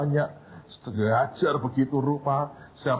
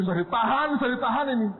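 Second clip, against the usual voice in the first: trembling fear; 11 semitones higher than usual. Retold or imitated.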